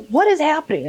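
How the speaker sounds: high-pitched mock bewilderment; baffled alarm. Imitating the confused boyfriend mid-laughter, baffled mock alarm. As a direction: ask it high, thoroughly thrown.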